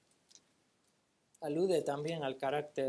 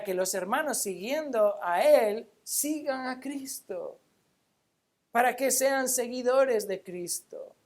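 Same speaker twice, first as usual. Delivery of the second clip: raised, forceful pulpit delivery; emphatic preaching; 5 semitones higher, 7 dB louder than usual. Real, not acted. Preacher urging the congregation to follow Christ, emphatic preaching. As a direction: drive each point home to the congregation, louder.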